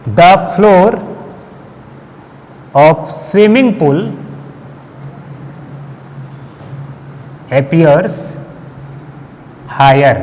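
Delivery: word by word, long pauses between phrases; measured, halting dictation pace; slow dictation. Real, not acted. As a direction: dictate slowly for note-takers. Teacher dictates an example for notes.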